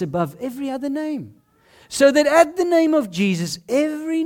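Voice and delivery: rising, building to a loud Lord; solemn reading building to a climax; scripture reading. Acted. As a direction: proclaim the verse. Reading Philippians aloud.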